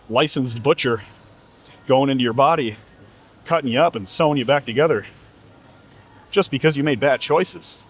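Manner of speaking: ordinary delivery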